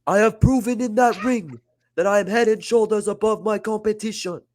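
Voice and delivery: high, slow, hammed-up African accent as a haughty ruler; worst accent ever. Oba Femi scolding rivals in a terrible hammed-up African accent. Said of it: "I'm gonna do the worst accent you've ever heard".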